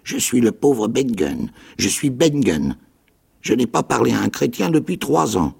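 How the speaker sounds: grind it out like a rusty lock; rusty castaway voice. A narrator's reading. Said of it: "sa voix était rauque et étrange".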